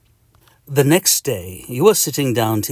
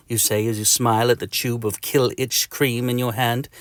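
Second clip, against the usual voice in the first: usual speaking voice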